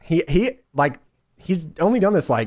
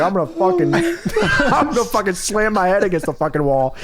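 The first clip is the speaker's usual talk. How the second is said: manic laughing promo; 4 semitones higher than usual. Retold or imitated. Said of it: "He's like".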